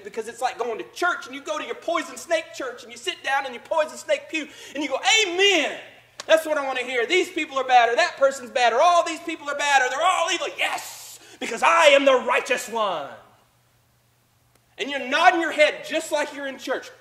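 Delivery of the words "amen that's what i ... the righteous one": louder, higher hammed-up churchgoer; mock self-righteous amen. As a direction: play a smug believer shouting agreement. Mock congregant in poison church.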